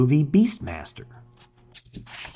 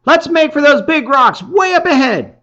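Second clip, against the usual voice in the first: higher, louder call from the rocks; shouting down; 13 semitones higher, 6 dB louder than usual. A narrator's reading. Chark calling down to his friend from rocks, shouting. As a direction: call it down from above. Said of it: "shouts down".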